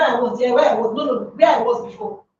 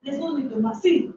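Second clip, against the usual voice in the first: usual speaking voice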